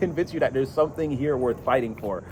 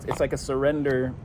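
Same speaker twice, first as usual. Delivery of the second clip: usual speaking voice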